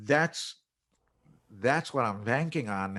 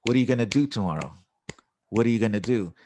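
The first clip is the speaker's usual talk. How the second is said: fast, beat-driven "What are you gonna do"; quick metronomic drill on "gonna do"; rhythmic cadence; 4 dB louder, much faster than usual. Shown as a demonstration. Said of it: "I create these little cadences".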